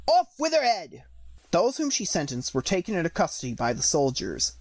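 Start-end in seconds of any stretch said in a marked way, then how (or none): 0.1-1.0 high furious royal shout; shouting Queen. Acted. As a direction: bellow the sentence.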